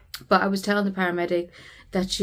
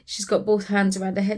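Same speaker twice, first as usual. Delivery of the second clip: normal talking voice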